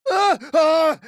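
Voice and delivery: loud, high hurt yelps; cries of pain. Acted. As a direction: cry out as two blows land. Fighter takes hits.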